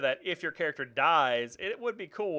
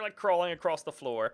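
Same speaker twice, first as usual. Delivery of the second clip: usual speaking voice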